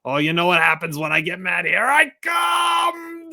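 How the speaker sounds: put-on comic old man working up to a yell; Don Zimmer charging. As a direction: wind up and yell as you charge. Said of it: "says something like".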